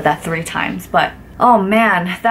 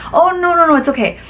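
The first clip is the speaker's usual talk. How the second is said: high, hurried nervous no; flustered refusal; 9 semitones higher, 3 dB louder than usual. Retold or imitated.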